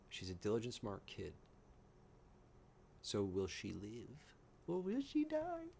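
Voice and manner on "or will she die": very high, drawn-out teasing question; mock-ominous falsetto; playful suspense. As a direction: tease it in a squeaky singsong. Teasing who lives or dies.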